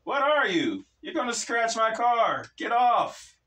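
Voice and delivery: high, loud outraged shouting; angry yelling. Acted. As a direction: yell at him to get off. G yells at demon on car.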